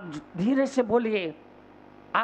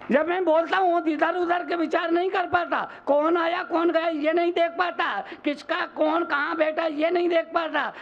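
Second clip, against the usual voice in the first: high-pitched, relentless preaching retort; forceful scolding; 7 semitones higher than usual. Retold or imitated. Monk telling a shopkeeper his real trouble, forceful scolding. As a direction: hammer out each charge high and ringing.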